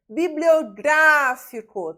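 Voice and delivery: "Bibliográfico" with a long, loud, high GRÁ; stressed syllable demonstration. Shown as a demonstration. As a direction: slowly punch out GRÁ in "Bibliográfico". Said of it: "e proparoxítona".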